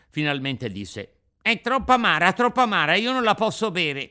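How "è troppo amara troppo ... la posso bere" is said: put-on high, loud boyish protest; Pinocchio, whining puppet child. Pinocchio refusing the Fairy's bitter medicine, loud whining. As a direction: wail like a balky puppet boy.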